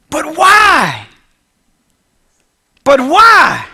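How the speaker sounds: loud high cry of why; shouted why. Acted. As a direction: cry out the question. Joab's outcry.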